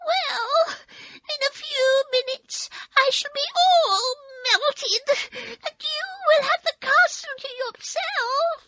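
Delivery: very high crying witch wail; a melting witch wailing her end. Acted. Melting witch's last words.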